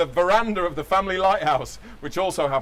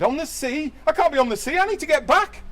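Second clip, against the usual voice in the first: panicky Larry; 10 semitones higher than usual. Acted.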